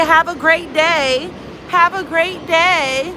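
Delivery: high, slow mock farewell; mocking sign-off. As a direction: mimic them, dragging it out high. Mimicking someone's sign-off from chat.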